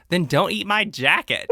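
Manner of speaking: imitated snappy retort. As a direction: throw back the punchline, amused. Acts Miranda's retort in a fight.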